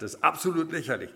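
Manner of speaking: high, loud indignant tirade; loudmouthed student outburst. Acted. Cheeky student blasts his professor over an exam, indignant outburst. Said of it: "einer der vorlauten Sorte war, der hat dann gesagt".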